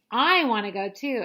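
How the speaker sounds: loud whiny demand; mock scream. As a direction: yell it like a left-out toddler. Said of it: "Miriam screams".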